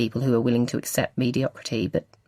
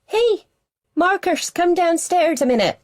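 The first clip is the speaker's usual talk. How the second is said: high, loud shout up the stairs; head-banging volume, calling him down; loud calling mum; 4 dB louder than usual. A narrator's reading. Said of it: "His mum has two volumes, normal and head-banging".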